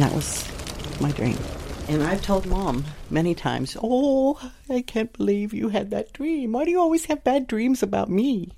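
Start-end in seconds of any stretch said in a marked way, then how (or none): 3.8-8.5 higher mock-hurt mother voice; imitating mom. Daughter voicing mom protesting her bad dreams, higher and hurt. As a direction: play mom, high and wounded.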